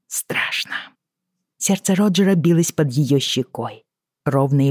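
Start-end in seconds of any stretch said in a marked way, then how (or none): 0.1-0.7 a soft breathy whisper of fear. A narrator's reading. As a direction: whisper the word Страшно, barely voiced. Fearful confession to lover.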